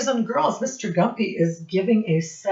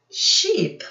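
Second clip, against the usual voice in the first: plain reading voice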